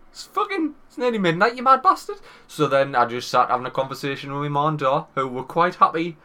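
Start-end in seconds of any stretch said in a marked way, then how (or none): 0.1-2.2 mock scolding. Scolding dad, mock outrage.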